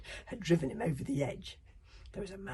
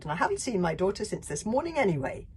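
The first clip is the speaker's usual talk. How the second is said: bellowing; 5 semitones higher, 7 dB louder, faster than usual. Acted. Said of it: "she bellowed".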